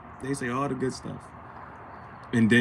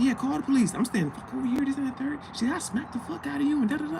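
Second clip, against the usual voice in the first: much higher, mocking her yelling; mock angry girlfriend; faster than usual. Girlfriend daring him to call police, high mock voice. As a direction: imitate her defiant screech.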